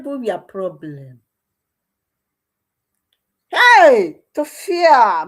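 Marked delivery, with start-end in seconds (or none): 3.5-5.2 high, loud cry of disgust; loud outcry. Real, not acted. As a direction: cry out and spit in contempt. Outraged cry, spitting in disgust.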